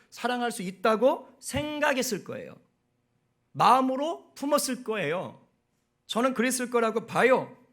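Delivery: impassioned pulpit preaching; preaching at full force. Acted. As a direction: preach it hard to the congregation.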